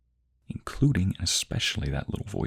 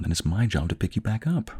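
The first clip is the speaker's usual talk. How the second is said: ordinary talk, nothing marked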